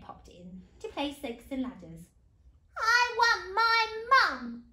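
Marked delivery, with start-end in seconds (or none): 2.8-4.6 loud, high, drawn-out bawl; storybook wail at full volume; bawling princess. A narrator's reading. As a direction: bawl slowly like a small child. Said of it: "bawled the little princess".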